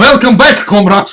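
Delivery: loud, high, hammed-up Russian greeting; big cartoon Russian welcome; mock Russian accent. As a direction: boom it out in a joke Russian accent. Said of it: "Any accent works".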